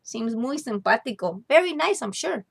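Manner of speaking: tiny mouse convincing himself; a hint of squeaky mouse; papa mouse. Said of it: "Papi said".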